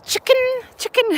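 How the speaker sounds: put-on Scouse accent; quick Scouse demonstration; Liverpool accent. Shown as a demonstration. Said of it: "And Liverpool, we all, we say about".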